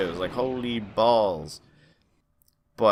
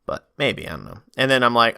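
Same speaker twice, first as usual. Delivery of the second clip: ordinary delivery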